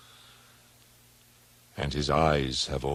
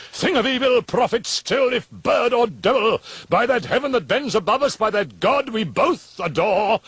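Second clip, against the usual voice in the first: desperate pleading; 14 semitones higher, 7 dB louder than usual. Acted. Narrator shouts pleas at raven.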